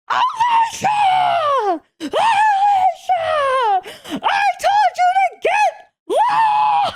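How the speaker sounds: high, drawn-out shriek of a furious coach. Retold or imitated. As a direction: screech her name across the court like an angry coach. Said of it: "my coach shrieked into my soul".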